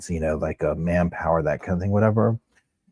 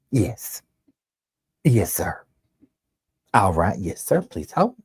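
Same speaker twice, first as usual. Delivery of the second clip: Heidi imitation; 2 semitones higher, slower than usual. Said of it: "She'd be like".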